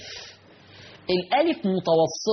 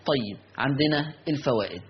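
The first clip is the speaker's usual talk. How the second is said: normal talking voice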